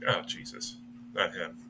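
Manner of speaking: low muttered groan of dismay. Acted. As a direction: mutter it under your breath, dismayed. NPC dismayed at arrival.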